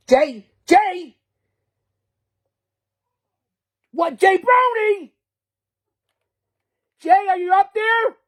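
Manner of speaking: high, loud shouts up to someone far off; shouting for Jay overhead; calling out. Real, not acted. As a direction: call up the stairs to Jay.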